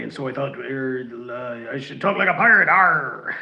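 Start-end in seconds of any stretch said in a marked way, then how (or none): 2.0-3.4 a hint of a pirate voice, louder. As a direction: growl out a playful pirate Arr. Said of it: "it's Talk Like a Pirate Day".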